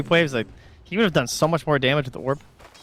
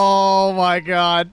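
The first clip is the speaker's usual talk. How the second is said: stunned; 6 semitones higher, 5 dB louder, much slower than usual. Real, not acted. Teammate steals jungle stacks.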